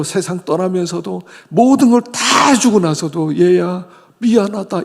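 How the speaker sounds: preaching. Real, not acted. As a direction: drive it home from the pulpit. Preaching at full force.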